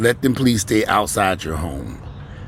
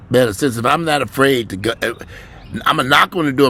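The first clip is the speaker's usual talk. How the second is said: usual speaking voice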